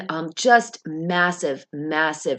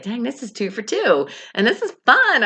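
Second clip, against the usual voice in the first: bursting with excitement, rising higher and louder; excited; 7 semitones higher, 3 dB louder than usual. Retold or imitated. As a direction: cheer yourself on, thrilled. Excited retelling of serving success.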